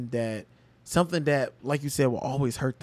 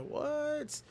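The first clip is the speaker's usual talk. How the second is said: high, startled gasp of disbelief; a shocked, breathless what; stunned disbelief; 6 semitones higher, 3 dB quieter, much slower than usual. Retold or imitated. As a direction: react like you can't believe your eyes. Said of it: "I was like".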